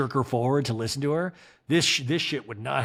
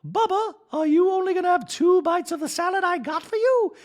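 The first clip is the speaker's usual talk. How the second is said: much higher, louder sing-song wife voice; a doting wife, pitched way up; Dorit impression; 5 dB louder than usual. Wife nagging husband about salad, high mock voice. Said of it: "and Dorit's like".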